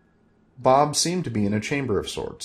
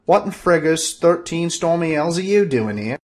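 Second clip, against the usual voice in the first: high, loud demand in a put-on voice; demanding little man; 8 semitones higher, 5 dB louder than usual. Little man demanding why Bob is there, high and loud. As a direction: bark it out, indignant. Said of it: "he demanded".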